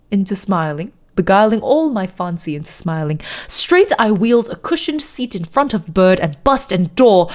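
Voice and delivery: poem recited in put-on British; hammed-up British poetry reading; fake British accent. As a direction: read dramatic verse in a mock British accent. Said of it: "middle of a fake British accent".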